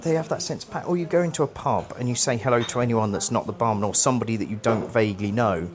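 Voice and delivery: normal talking voice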